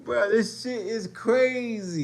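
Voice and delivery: high, loud, drawn-out incredulity; real high-pitched outburst of shock; outraged disbelief. Real, not acted. Outraged reaction to what he watches.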